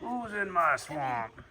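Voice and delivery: slow, lightly put-on ogre voice; mock Shrek growl. Owner teasing the grumpy pig as an ogre, slow mock growl. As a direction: drawl it out like a grumpy swamp ogre, half joking.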